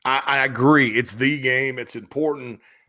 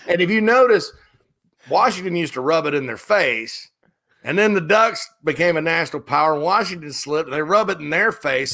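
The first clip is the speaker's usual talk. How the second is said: normal talking voice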